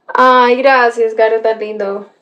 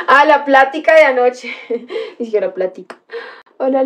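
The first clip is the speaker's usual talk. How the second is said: ordinary talk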